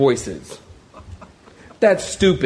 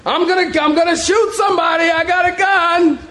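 high-pitched mock-panicked threat; comic frightened bluff, voice pitched way up; panicked warning; 12 semitones higher than usual. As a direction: call out scared to an intruder, hammed up. Scared, warning an imagined intruder.

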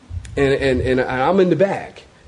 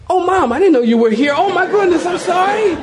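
high, rushed mock-flustered apology; hammed-up embarrassed surprise; startled apology; 12 semitones higher than usual. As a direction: gush a comic apology, voice climbing. Startled apology to Mom in story.

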